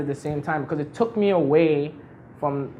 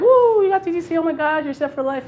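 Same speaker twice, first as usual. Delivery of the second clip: high delighted whoop; thrilled aunts; 13 semitones higher, 3 dB louder than usual. Retold or imitated. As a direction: gush like the relatives who think he's hit the jackpot. Aunts thrilled about TTC job.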